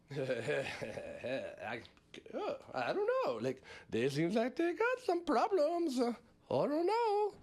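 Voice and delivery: a shrugging, clueless Putin; mock Putin. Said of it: "this is him".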